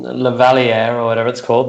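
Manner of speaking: ordinary delivery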